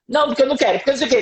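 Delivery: a light imitation of a sleepy child refusing; whiny child. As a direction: voice the cranky sleepy kid. Child refusing to brush teeth.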